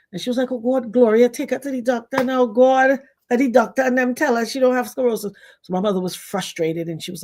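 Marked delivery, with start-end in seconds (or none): 0.5-5.3 higher, fed-up grandmother; exasperated grandma. Retold or imitated. Grandma tells Gloria to take her to the doctor, exasperated. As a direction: throw up your hands.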